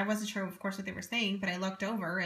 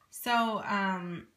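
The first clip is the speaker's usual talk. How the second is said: ordinary talk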